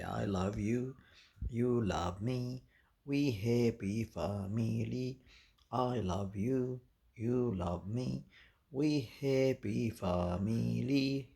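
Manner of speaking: very slow, word by word, for the bird to repeat; slow teaching to the budgie. Shown as a demonstration. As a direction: spell it out slowly so the budgie can copy it. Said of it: "Yalla, say it".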